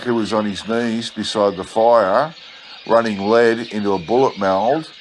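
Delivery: plain reading voice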